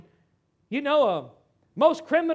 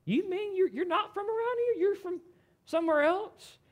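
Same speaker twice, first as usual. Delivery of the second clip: high-pitched incredulous questioning; mock surprise; 9 semitones higher than usual. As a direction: pitch it up, puzzled by the stranger. Voicing surprise at a foreigner's accent.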